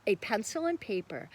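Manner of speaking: usual speaking voice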